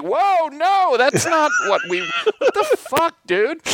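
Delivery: a fan recoiling in mock horror; high squealing disbelief, dragged out; outraged disbelief. Fans reacting to a lame backstory.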